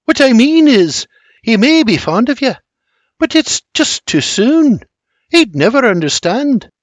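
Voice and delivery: put-on high, earnest Scottish girl; Scottish Mary, earnest. A narrator's reading. Mary explains her father to William, high and earnest.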